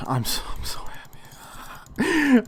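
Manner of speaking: slow, breathless whisper of joy; overjoyed whisper. Real, not acted. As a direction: whisper it in happy disbelief, slowly. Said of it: "I just audibly gasped".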